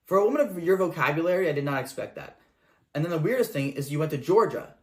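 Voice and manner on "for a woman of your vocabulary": normal talking voice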